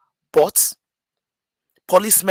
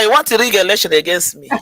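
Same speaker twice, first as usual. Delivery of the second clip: playful indignant jab, much louder; mock accusation; 4 semitones higher, 10 dB louder than usual. To the host about being skipped, mock outrage. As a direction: tease the host like a cheated candidate.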